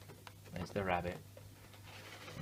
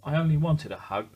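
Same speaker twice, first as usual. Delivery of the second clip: sniffing; 5 semitones higher, 6 dB louder than usual. A narrator's reading. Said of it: "sniffed Douglas".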